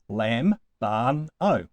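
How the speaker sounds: lambano split into three separate syllables, LAM, BAN, O. Shown as a demonstration. Teacher counting out the syllables of a Greek verb, syllable by syllable. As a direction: break lambano up with a clear pause after each syllable. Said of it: "Three syllables".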